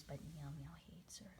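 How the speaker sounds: soft, fast secret whisper; whispered aside. Real, not acted. Confiding gossip about the cat.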